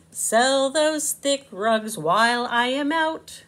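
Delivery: lightly voiced bossy boss; bossy Slug. Slug ordering Bug to sell rugs, lightly bossy. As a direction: order the worker about.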